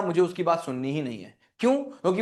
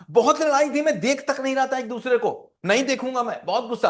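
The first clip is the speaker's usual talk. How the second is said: heated retelling; 7 semitones higher, 3 dB louder than usual. Retold or imitated. Retelling a big fight, raised voice.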